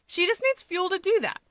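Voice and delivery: arguing. A narrator's reading. Hawks argues Rika needs food, lightly voiced wheedling. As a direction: argue your way to a meal. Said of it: "Hawks argues".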